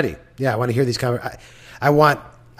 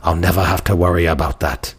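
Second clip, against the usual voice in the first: Littlefinger impression; 6 semitones lower than usual. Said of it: "perfect for Littlefinger".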